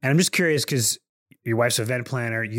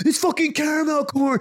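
mock shouting; 14 semitones higher than usual. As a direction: pretend fury over snacks. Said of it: "I can imagine you on the L I E entrance going".

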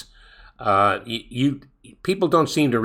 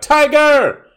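high, loud yell like a golf fan; fan's yell; 7 dB louder than usual. Retold or imitated. Golf fan yelling to Tiger Woods on the fairway, loud yell. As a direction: call out the golfer's name across the course. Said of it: "everybody yelling out".

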